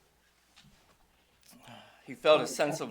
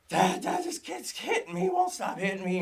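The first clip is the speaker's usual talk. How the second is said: high-pitched imitation of a distraught kid; upset little boy; 11 semitones higher than usual. Young son running in to tell Dad, upset. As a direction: mimic a child running in to tell on someone. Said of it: "He's, like, all a mess".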